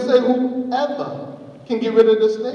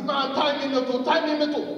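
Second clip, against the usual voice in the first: higher, softer pleading; pitched-up, repeated urging; eager snake; 7 semitones higher, 4 dB quieter than usual. Acted. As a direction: beg eagerly as the gullible snake. Eager snake begs to be tied.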